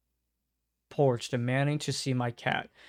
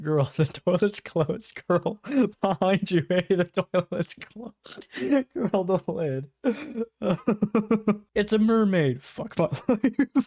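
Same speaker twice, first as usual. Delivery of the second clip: high-pitched, breaking up with laughter; helpless laughter between slow, broken phrases; laughing while reading; 7 semitones higher, 3 dB louder, slower than usual. Real, not acted. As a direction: struggle to get the words out through giggles. Cracking up while reading aloud.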